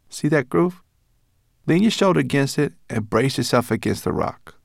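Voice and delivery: high, brisk little creature voice; a furry guide, pleased with himself; Berber Chan's voice. Acted. Small guide explaining the hidden door, high and brisk.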